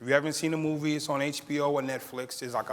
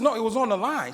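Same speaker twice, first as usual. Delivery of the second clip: put-on higher, louder disbelieving kid; boy arguing with the lunch lady, higher and louder; indignant protest; 7 semitones higher, 5 dB louder than usual. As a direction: protest that it was free. Boy protesting cake charge.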